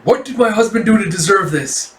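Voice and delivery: acted distress without sobbing; tearful protest. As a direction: cry out against the injustice. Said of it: "tears streaming down her eyes".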